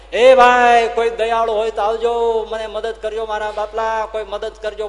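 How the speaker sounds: high desperate shout for help; calling for help. Retold or imitated. Farmer calling neighbours in the storm, high desperate shout. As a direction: cry out into the storm for anyone.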